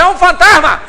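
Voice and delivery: loud frightened yell of a ghost sighting; mock terrified scream. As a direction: shout it like a panicked fisherman. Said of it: "alguém grita". (grito apavorado imitado)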